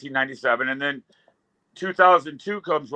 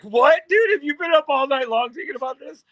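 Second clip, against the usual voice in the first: very high, louder disbelieving outburst; mock incredulity; 11 semitones higher, 3 dB louder than usual. Just-woken man questions his high friend, mock incredulous. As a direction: leap up in pitch, incredulous.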